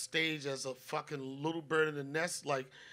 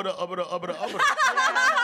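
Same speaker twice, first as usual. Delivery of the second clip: mock mumbling that gets louder and breaks into a laugh; mocking nervous mumble, building to laughter; 11 dB louder than usual. Mocking a nervous open-mic comic, louder and laughing. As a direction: mimic a nervous comic stalling, then crack up. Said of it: "You know, it's like".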